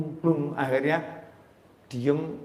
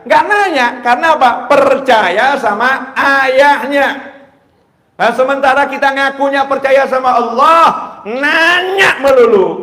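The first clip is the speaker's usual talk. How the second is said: preaching at full force; 11 semitones higher, 12 dB louder than usual. Acted.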